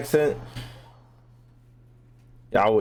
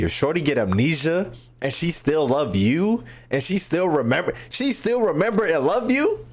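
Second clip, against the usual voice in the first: incredulous; 7 semitones higher than usual. Real, not acted. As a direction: pitch climbing higher in disbelief. Streamer reacts incredulously to amnesiac lover.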